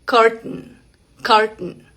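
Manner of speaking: "curtain" with the T stopped in the throat, ending in a nasal n; glottal T. Shown as a demonstration. Teacher showing Spanish speakers the American word, glottal T. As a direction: hold the T of "curtain" in the throat, no vowel after it. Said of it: "vas a pronunciarla así".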